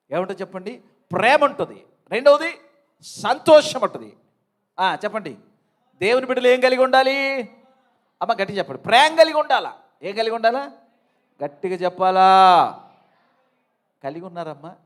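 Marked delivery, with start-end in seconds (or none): 1.1-1.9 ప్రేమ ఉంటుంది shouted out much louder; preacher loudly modelling the reply; loud call. Shown as a demonstration. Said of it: "గట్టిగా చెప్పాలి మీరు". 2.1-12.9 loud, high fiery preaching; high-pitched shouted sermon calls; preaching at full force. Acted.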